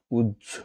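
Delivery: উহ্য said as "ujjho"; উহ্য, softly, as "ujjho"; correct pronunciation. Shown as a demonstration. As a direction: pronounce উহ্য with a jjh sound. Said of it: "শুদ্ধ উচ্চারণ কোনটি".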